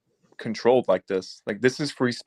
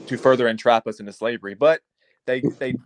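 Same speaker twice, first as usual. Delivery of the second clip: usual speaking voice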